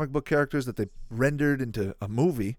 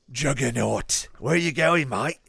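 put-on Aussie accent, punched in the middle; Australian accent; 4 dB louder, slower than usual. Gamer voicing Juggernaut from the movies, mock Australian accent. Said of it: "like an Australian accent".

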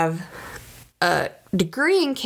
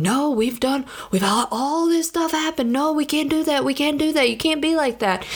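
put-on much higher, insistent voice of a weary older self; stern inner self; 9 semitones higher than usual. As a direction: scold your younger self, pitched way up. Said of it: "my thirty-something-year-old self is sitting there going".

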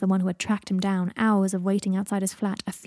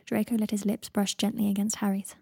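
plain reading voice

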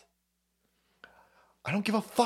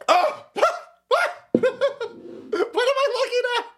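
high, fast words through real laughter; laughing disbelief. Real, not acted. Streamer reacting to a shocking image, laughing high.

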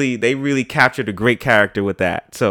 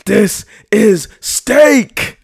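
slow, loud, higher epic roar over something trivial; mock Leonidas bellow; 9 semitones higher, 5 dB louder, much slower than usual. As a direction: shout it like a battle cry. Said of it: "if he was cooking dinner for you".

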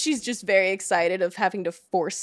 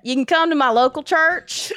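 louder Southern church-lady invitation; Southern accent; 6 semitones higher, 5 dB louder than usual. Pretend. Guest repeats the invitation in a thick Southern accent. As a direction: invite them like a small-town Southern churchgoer. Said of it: "in my thickest, uh, Southern accent".

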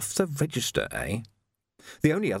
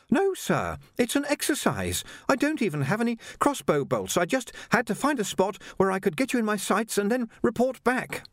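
put-on much higher, polite young Jocasta, a touch breathless; young woman voiced; 11 semitones higher than usual. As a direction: play a well-mannered girl treading water. Jocasta explains exercise.

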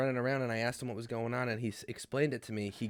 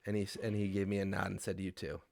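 quiet retold farewell; 5 semitones lower, 8 dB quieter than usual. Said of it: "I just said".

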